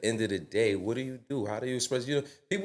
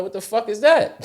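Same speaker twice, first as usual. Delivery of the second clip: shocked disbelief; 12 semitones higher, 6 dB louder than usual. Retold or imitated. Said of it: "I'm like".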